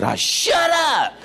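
high, slow, laughing mock yell at the TV; hollering at the TV. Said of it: "So I'm hollering at the T V".

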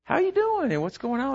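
high friendly how-are-you, falling away at the end; cheery greeting. Retold or imitated. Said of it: "And so I said".

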